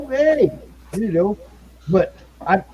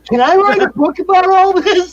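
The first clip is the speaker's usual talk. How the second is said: much higher, louder, laughing through it; laughing mock question; 7 dB louder than usual. Imagined future Travis asking, laughing.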